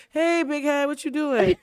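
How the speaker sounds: high, loud playful call; teasing family greeting. Retold or imitated. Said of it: "just be like".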